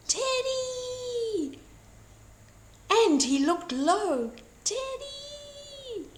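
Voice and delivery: high drawn-out calls; high anxious calls for his teddy; calling out. A narrator's reading. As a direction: call out searching for him. Wolf calling for lost teddy.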